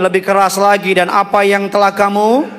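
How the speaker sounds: ordinary delivery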